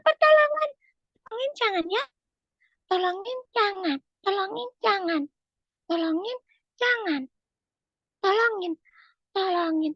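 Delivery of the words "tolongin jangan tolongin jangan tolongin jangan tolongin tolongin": low, measured weighing-up; deliberating to oneself, with pauses; hesitant pondering. Acted. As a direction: mull it over in a lowered voice. Aulia ponders whether to help.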